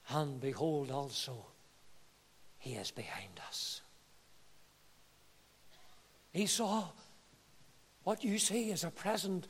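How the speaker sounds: very soft, low, halting delivery; hushed servant's message. Acted. Servants telling Esau that Jacob follows, hushed and low. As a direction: drop almost to a whisper, with long pauses.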